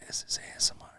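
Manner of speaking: ASMR-style whisper. Pretend. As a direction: breathe the question in a whisper.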